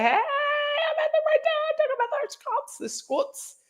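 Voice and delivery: high-pitched, drawn-out mock viewer voice; acted-out viewer. Reading Jojo's comment aloud to the chat, acted high-pitched. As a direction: call it out high and long.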